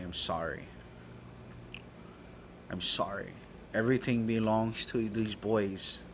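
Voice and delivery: subdued imitation of a tearful auntie; breaking down, retold. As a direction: say sorry softly, voice dropped. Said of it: "she fucking breaks down".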